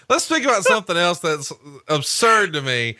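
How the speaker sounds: ordinary talk, nothing marked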